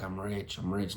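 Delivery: low, very quiet repetition; soft, lifeless self-talk; unconvinced mirror affirmation. Shown as a demonstration. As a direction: murmur it with no conviction. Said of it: "like, in the mirror".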